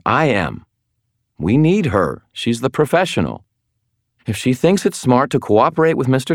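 firm. Acted. Anna firmly stepping in.

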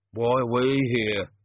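slow, faraway musing; distant slow voice. Acted. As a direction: say it absently, miles away. Peachy distant.

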